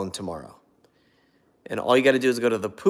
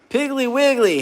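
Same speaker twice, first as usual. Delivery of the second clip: excited; 7 dB louder than usual. Real, not acted. Excited idea.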